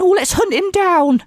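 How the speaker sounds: mock him in a high squeaky whine. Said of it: "is going".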